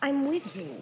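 ordinary talk

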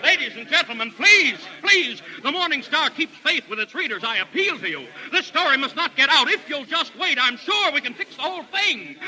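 high, loud appeal; desperate pleading. Acted. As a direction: beg the crowd. Pleading with the crowd.

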